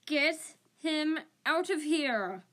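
loud, high, drawn-out bellow. A narrator's reading. Ron orders the attacking cat out, bellowing. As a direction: bellow it slowly, stretching every word. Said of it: "Ron bellowed".

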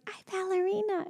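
put-on high little-girl voice; toddler voice. Mother imitating her daughter after the parade. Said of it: "she was like".

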